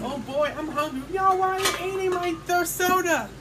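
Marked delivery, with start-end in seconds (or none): 1.1-3.3 angry demand. Acted. Toy fights over eaten food.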